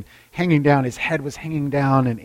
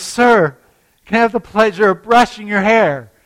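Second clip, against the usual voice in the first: higher, louder polite offer; politely raised voice; 7 semitones higher, 7 dB louder than usual. Retold or imitated. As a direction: lift it so a deaf old man hears. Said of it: "So she says".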